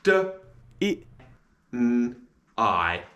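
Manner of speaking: d, i, n, I each said alone; sounding out deny. Shown as a demonstration. Teacher sounding out deny. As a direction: segment "deny" into four sounds. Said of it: "And the sounds of deny are".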